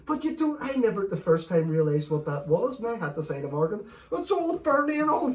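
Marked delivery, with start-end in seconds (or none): 4.1-5.3 high, raised mock panic; panicked complaint. Retelling chilli burn complaint to partner, panicked complaint. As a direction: wail it in burning distress.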